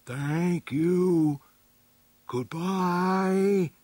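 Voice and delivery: high, long-drawn creature farewell; creature's thought-voice. A narrator's reading. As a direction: stretch each word high, like a beast's thought. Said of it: "caught a faint thought from the Stegosaurus".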